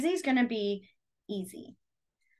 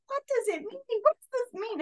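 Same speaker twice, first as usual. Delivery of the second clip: confused asking; 12 semitones higher than usual. Retold or imitated. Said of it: "I was like".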